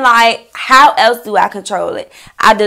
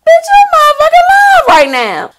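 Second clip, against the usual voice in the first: much higher, louder sarcastic squeal; incredulous sass; 5 dB louder than usual. Retold or imitated. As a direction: fling it back, scandalized. Retorting to backstabbing friend.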